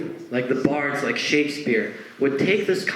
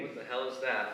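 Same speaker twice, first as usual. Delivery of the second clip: much softer, laughing aside under the breath; honest aside; 13 dB quieter than usual. Acted. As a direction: sneak the truth out quietly.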